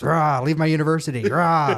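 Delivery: loud, growling mock monster roar; hammed-up cartoon monster threat. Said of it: "And then the monster says".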